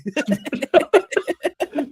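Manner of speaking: real high-pitched burst of laughter; laughing. Real, not acted. Host amused by a fan's chat message, high laughter. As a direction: crack up helplessly at the joke.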